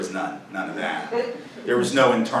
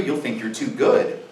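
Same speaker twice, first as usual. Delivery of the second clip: ordinary delivery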